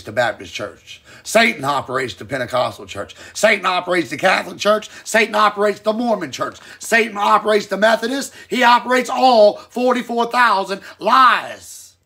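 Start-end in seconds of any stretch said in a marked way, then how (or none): 1.2-11.9 full-force preaching. Acted. Preacher to his live audience, building loud. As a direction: hammer each church name harder, preacher style.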